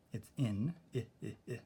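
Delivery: "in" and "I, I, I" as a short i, like "ih"; short I sound. Shown as a demonstration. Teacher modelling the short vowel for the class. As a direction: clip each "I" to a short ih. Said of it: "That's the short I".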